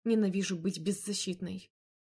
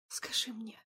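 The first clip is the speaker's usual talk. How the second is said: softer, tender near-whisper; 3 semitones higher, 6 dB quieter, slower than usual. Acted. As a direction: whisper his name gently. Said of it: "ласково прошептала я".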